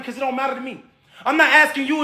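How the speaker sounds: ordinary delivery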